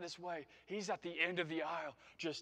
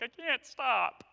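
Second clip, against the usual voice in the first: slow, higher, mock-teary whine; hammed-up tearful wedding guest; mock wedding crier; 7 semitones higher, 6 dB louder, slower than usual. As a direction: play a guest who can't stop crying at a wedding. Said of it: "you're like".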